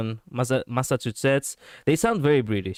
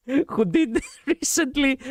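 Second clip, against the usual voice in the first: laughing; 13 semitones higher, slower than usual. Real, not acted. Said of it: "laughing mid sentence".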